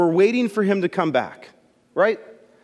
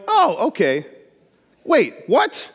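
double take; 4 semitones higher, slower than usual. Retold or imitated. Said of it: "they're like".

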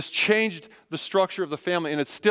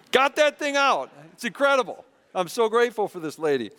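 excited relief; 7 semitones higher than usual. Real, not acted. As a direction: cheer that the knot is out. Relief after knot removed.

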